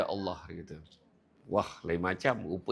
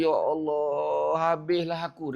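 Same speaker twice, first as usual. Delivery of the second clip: slow, higher, louder panic, drawing out Ya Allah; panicked cry; 4 semitones higher, 4 dB louder, slower than usual. Acted. Young companion fleeing in shame, slow panicked cry. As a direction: cry out in dread at the wrongdoing. Youth panics after seeing.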